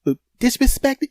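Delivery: high-pitched, approving woman's voice; encouraging lady. Retold or imitated. Lady encourages the struggling foreigner, high and approving. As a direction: cheer him on in a high voice. Lady supplies the missing word.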